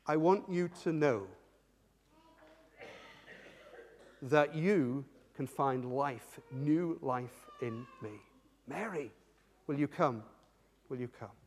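slow, low, hushed appeal; softly coax, fading to almost nothing; tender invitation. Acted. Jesus invites Mary to new life, tenderly.

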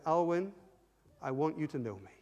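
low, soft personal appeal; gentle call. Retold or imitated. Jesus speaks to ten-year-old boy, gently. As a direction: speak it quietly and intimately.

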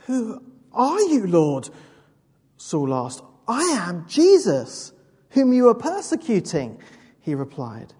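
dramatic Bible reading to the congregation; scripture reading. Acted. Preacher reads Saul's conversion to the church, dramatic reading. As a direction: read the passage like a vivid scene. Reads the Damascus road passage.

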